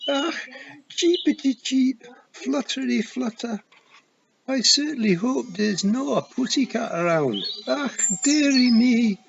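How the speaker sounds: high, sing-song Scottish cheeping, "poor wee me" drawn out; a wee man hamming up a hurt baby bird; Scottish feegle squeaking like a chick; fake bird cheeping. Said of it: "it yelled".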